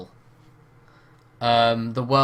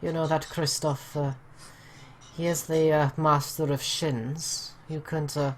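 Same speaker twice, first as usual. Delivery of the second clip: put-on Welsh accent. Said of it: "really bad Welsh accent".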